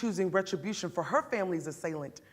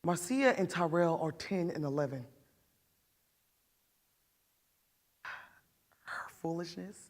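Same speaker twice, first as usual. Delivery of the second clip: put-on remember the children's lost innocence, quietly pained; understated grief of a mother; grieving mother; 2 semitones lower, 3 dB quieter than usual.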